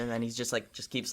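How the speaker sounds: ordinary talk, nothing marked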